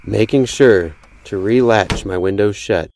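ordinary delivery, nothing marked

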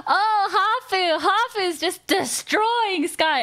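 high, hyped commentator cry; mock hyped announcer; excited judges. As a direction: egg the fight on like a judge. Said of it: "They're like".

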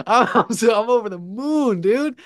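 high-pitched, giddy elation; over the moon. Retold or imitated. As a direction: retell it elated, voice soaring high.

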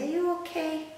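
a light touch of a gentle rabbit; kind rabbit. Acted. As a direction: ask it like a kindly bunny. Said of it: "he's saying".

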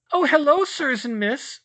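very high, loud squeak; a tiny house-elf squeaking a greeting; squeaky elf. A narrator's reading. Elf greeting the children in a high squeak. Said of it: "squeaked the first elf".

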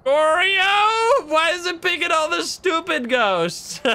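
high frustrated yell; exasperated cry. Real, not acted. Wheel lands on unwanted ghost.

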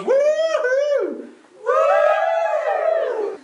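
put-on very high, drawn-out girly woo-hoo; captain's daughter. Shown as a demonstration. Leader shows the captain's daughter, high woo-hoo. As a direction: squeal it in a high falsetto. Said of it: "The captain's daughter does a little leg bob".

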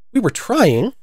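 high, pleading little explanation; humble subject; humble excuse. A narrator's reading. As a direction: offer a meek excuse, pitched high. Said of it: "said Two very humbly".

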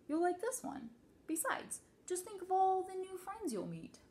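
high, upbeat coaxing; encouraging parent. A narrator's reading. Father coaxing daughter about new school, high and encouraging. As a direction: lift the pitch and sell the good news.

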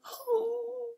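high drawn-out "ooh" of a gasp; excited gasp. Pretend. Nana reacting to a child's third day at school, excited gasp.

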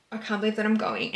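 ordinary talk

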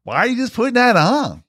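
very high put-on bumpkin voice; Gomer Pyle. Co-host mocking the actor's put-on voice, high goofy impression. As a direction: pitch it way up in a comic yokel impression. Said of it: "that's Gomer's voice, too. So it's like".

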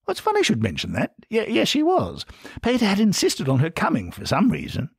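high, innocent British reply; genteel upper-class British, unsuspecting. A narrator's reading. Bernard answers Ferret innocently, upper-class British. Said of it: "his thoroughly upper-class British voice".

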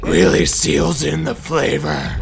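slow, lightly voiced low Batman; Batman impression. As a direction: drag out the punchline as Batman. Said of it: "College Humor Batman".

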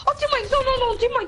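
pleading. Real, not acted. Begging teammate not to.

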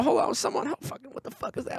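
whispered gamer panic; mock whisper. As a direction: hiss it under breath. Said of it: "playing video games".

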